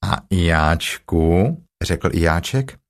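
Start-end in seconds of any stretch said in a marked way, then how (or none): 0.0-1.6 very slow, low interjection; low donkey drawl; gloomy Eeyore. Acted. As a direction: drag it out dolefully in a low voice. Eeyore adds himself to greeting.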